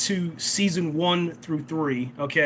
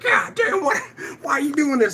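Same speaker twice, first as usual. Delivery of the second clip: high, strained disbelieving voice; imitating Aaron's panic, pitched way up; mock panicked cry; 9 semitones higher than usual. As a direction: shriek it like he can't believe it. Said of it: "Aaron's like".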